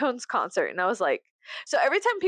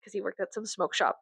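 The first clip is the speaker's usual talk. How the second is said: ordinary talk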